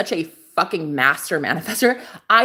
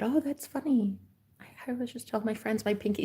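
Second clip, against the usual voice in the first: hushed, breathy retelling; retold whisper; 12 dB quieter than usual. Telling the neighbor about the pinky in a whisper. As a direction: whisper it across the table. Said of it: "And I said".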